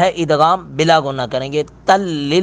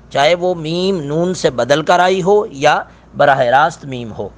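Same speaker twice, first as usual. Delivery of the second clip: ordinary delivery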